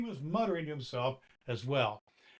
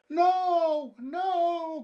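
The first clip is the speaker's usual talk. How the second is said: very high, loud crying no; tantrum cry; 7 dB louder than usual. A narrator's reading. Doll refusing Santa, high and loud in a tantrum. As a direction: refuse like a stamping child. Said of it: "cried Lady Arabella".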